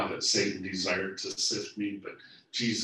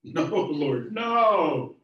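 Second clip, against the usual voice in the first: a high, loud cry of refusal; loud denial; 8 semitones higher, 9 dB louder, much slower than usual. Acted. Peter crying out to Jesus against the prophecy, loud and pained. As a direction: reject it with rising alarm.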